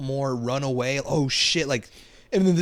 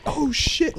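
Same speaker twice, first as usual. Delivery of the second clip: mild mock yell; 11 semitones higher than usual. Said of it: "I'd be yelling".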